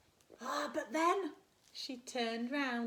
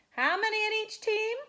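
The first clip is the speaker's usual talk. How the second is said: a teacher's loud, higher call to the class; 10 semitones higher, 8 dB louder than usual. Acted. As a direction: call the question across the playground. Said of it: "asked the teacher".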